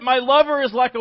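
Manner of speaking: high, loud and eager; excited bride. Acted. Bride praising her lover, high and loud. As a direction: lead into it breathlessly. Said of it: "Because what is it?".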